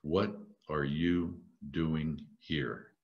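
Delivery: soft, low and very slow; each word set apart, quietly probing; still small voice. Acted. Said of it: "What did the still small voice said".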